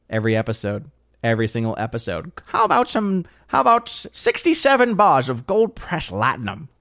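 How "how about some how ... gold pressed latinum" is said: Quark impression. Streamer imitating Quark offering latinum bars. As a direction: wheedle like a greedy trader.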